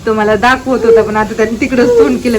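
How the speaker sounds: ordinary delivery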